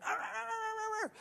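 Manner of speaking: high-pitched imitated pony wailing; long, warbling animal squeal of pain; injured pony cry. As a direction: imitate a hurt little pony crying out. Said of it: "rolled into the ditch going".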